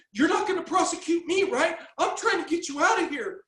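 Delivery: high, anxious mock pleading; pleading sergeant. Man imitating the sergeant pleading, high and anxious. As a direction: beg like a scared officer.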